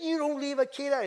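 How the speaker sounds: high, loud scolding; imitated angry telling-off. Older lady scolding the drunk father, imitated angry outburst. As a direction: go off on him, mimicking her outrage. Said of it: "went off on him".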